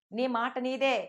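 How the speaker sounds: ordinary delivery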